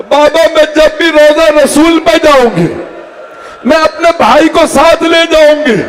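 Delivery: a mourning preacher voicing the grieving daughter; tearful daughter pleading. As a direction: plead with father, voice climbing in grief. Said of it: "ایک مرتبہ آنسو صاف کیے".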